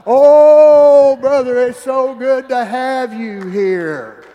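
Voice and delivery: loud, slow, overjoyed gush; hammed-up gushing church lady; gushing welcome. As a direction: belt out the welcome like she can't contain herself. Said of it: "and she".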